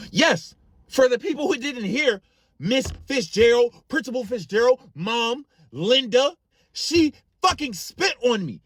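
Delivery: indignant roll call of everyone in charge; outraged. Retold or imitated. Cousin outraged at being spat on.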